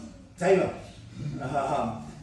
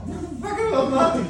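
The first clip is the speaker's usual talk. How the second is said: high, loud panicked question; imitating his own shock; mock panic; 12 semitones higher, 7 dB louder than usual. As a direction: squeal it in disbelief. Retold panicked reply.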